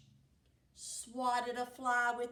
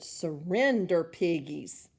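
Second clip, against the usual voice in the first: witch threat; 5 semitones lower, 8 dB louder than usual. Acted. Witch writes threat in sky.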